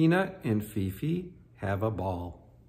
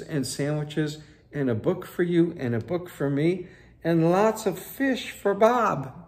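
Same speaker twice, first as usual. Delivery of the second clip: much higher, eager rush of plans; excited pitch; 6 semitones higher, 8 dB louder than usual. Acted. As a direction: sell the big adventure breathlessly. Fifi pitches circus adventure.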